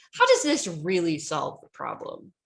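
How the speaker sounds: loud sarcastic challenge, swooping down low; sarcastic debater. Acted. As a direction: ask it with open sarcasm. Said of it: "I was a very sarcastic debater".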